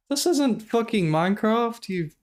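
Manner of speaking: high, slow, incredulous protest; exasperated outburst. Real, not acted. Streamer exasperated at a runner not resetting, high incredulous protest. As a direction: throw up your hands at the screen.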